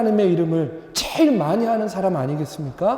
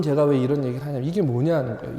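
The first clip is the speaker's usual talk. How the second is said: ordinary talk, nothing marked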